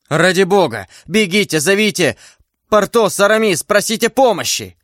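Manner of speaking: high, urgent calling; frantic appeal. Acted. Hero begging his friends to fetch help, frantic. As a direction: send friends running, pitch climbing.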